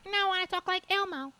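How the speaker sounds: playful high Elmo impression. Shown as a demonstration. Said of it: "if I'm talking like this right now".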